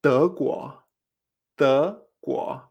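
"deguo" as two questioning rises; drawn-out "deguo", surprised on both syllables; tones two-two. Shown as a demonstration. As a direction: lift each syllable of "deguo" separately. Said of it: "Me extraño dos veces".

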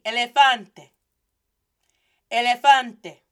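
loud, clear Spanish elefante; teacher drilling elefante for repetition; model pronunciation. Shown as a demonstration. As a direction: elefante with eh vowels and stressed FAN. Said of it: "Repeat after me".

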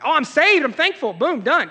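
mocking easy gratitude; glib believer. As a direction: toss it off like it's all settled.

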